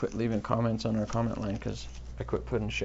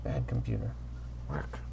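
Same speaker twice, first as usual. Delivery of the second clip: low, muttered scolding; 11 semitones lower, 4 dB quieter than usual. Real, not acted. Podcaster to his misbehaving computer, low muttered scolding.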